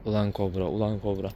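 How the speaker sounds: low, soft fond grumble; tender scolding. Real, not acted. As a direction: murmur his name fondly. Affectionate mutter at dog.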